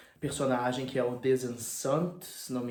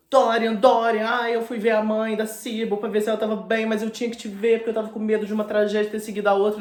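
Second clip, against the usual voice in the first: worried Basil; 6 semitones higher, 7 dB louder than usual. Retold or imitated. Basil anxiously bursting in.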